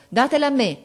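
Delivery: loud, high call to the classmates; boy cutting in with a loud cry; shouting. A narrator's reading. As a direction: shout it out to stop them. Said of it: "De Rossi gridò".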